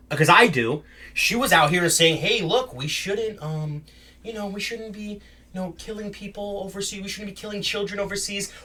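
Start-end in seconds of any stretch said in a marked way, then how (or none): 2.2-8.5 very soft, higher-pitched imitation; hesitant, hushed appeal on someone's behalf; soft tentative plea. Retelling a commentator's plea against killing overseas, soft and tentative.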